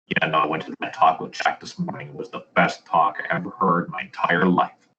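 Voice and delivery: low, disingenuous praise in a monotone; bored sarcasm. Shown as a demonstration. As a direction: say the compliment as if it bored you. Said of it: "it's sarcastic".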